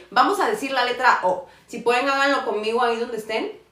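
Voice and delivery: usual speaking voice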